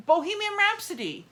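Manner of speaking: high, loud burst of fan excitement; excited gush. Real, not acted. Fan raving about her favourite bands, excited. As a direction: blurt the song title like it thrills you.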